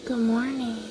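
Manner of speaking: soft, slow wake-up coo. Real, not acted. Waking her son gently.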